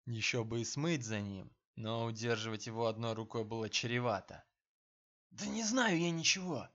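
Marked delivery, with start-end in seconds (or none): 5.3-6.7 high, rasping desperate denial; hoarse cry. A narrator's reading. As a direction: rasp it out in desperation. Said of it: "прохрипел мужик".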